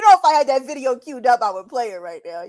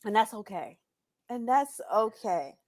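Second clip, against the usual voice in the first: soft mock reassurance; 9 dB quieter than usual. Real, not acted.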